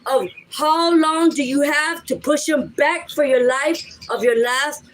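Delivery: ordinary talk, nothing marked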